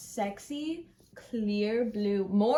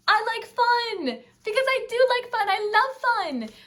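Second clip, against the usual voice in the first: high, loud gushing; squealing excitement; 12 semitones higher, 7 dB louder than usual. Real, not acted. Fan showing off her newest vinyl, squealing with joy. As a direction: squeal the album name with joy. Announcing last album excitedly.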